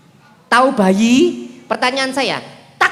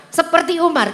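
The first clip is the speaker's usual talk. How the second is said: usual speaking voice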